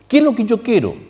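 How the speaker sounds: pitched well up, asking the room; high, inviting question; high rhetorical question. Acted. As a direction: raise the question high to the listeners.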